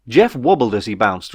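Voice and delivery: plain reading voice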